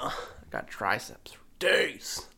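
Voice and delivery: slow whispered brag. Pretend.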